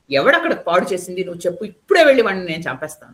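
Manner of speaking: loud, furious king's threat; a demon king shouting his fury; angry roar. A narrator's reading. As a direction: bellow it in rage at the messenger. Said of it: "కోపంతో గట్టిగా అరిచాడు".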